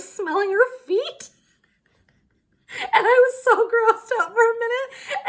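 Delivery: high, loud, laughter-choked telling; cracking up. Real, not acted. Telling viewers about the feet mix-up, laughing helplessly. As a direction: squeal the words through real laughter.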